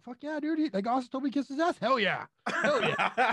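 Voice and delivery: high, fast excited whoop; mock-celebrate being insulted by a hero; thrilled cheer. Fan thrilled that Austin insulted him, excited. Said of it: "I was like".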